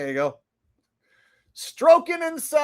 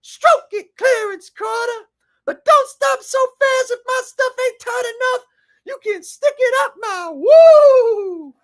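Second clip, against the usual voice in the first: high, loud put-on woman's voice; hammed-up high female voice; 12 semitones higher, 7 dB louder than usual. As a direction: squeal it like the woman in the song. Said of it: "She said".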